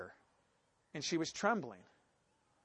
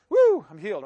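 loud joking woo; mock whoop; 2 semitones higher, 10 dB louder than usual. Pretend thief celebrating a stolen healing, loud woo. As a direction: cheer it out in jest.

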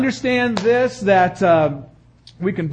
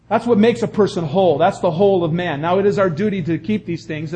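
ordinary delivery

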